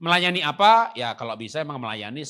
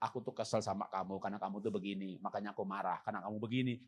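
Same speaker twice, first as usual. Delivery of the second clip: soft honest wife; 6 semitones lower, 8 dB quieter than usual. Retold or imitated. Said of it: "Ibu bilang".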